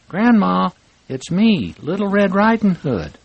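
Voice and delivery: put-on high, loud little girl's voice calling out; a cheerful child calling at grandma's door.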